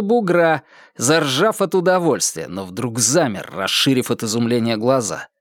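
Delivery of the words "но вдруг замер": ordinary delivery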